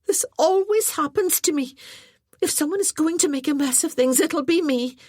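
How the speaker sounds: a clumsy girl wailing at her own bad luck; flustered young lass. A narrator's reading. Aileen voiced in story.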